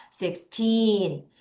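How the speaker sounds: put-on Sixteen with a long, strong TEEN; six-TEEEN, ending held; stress on TEEN. Shown as a demonstration. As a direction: stretch the second syllable of Sixteen. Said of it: "틴을 강하게 읽겠습니다".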